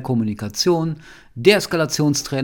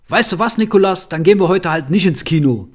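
higher and louder, snapping at his son; angry outburst; 5 semitones higher, 6 dB louder than usual. Retold or imitated. Father loses his temper at his son Nikolas, angry raised voice. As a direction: let it burst out in irritation. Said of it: "ich so ganz losgelegt".

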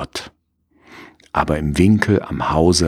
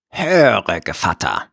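wolf voice. Acted. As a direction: coax like a cunning wolf. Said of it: "sagte der Wolf".

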